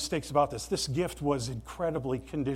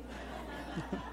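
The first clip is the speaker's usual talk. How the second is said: soft laugh; 14 dB quieter than usual. Real, not acted. Laughing at the movie scene.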